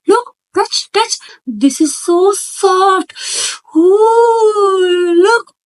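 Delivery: put-on over the top excitement to hook a baby; exaggerated baby talk. Shown as a demonstration. Mother showing a teddy to her toddler, high and exaggerated. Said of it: "मैं बच्चे के सामने bear रखूंगी और मैं verbally इसको pronounce करूंगी".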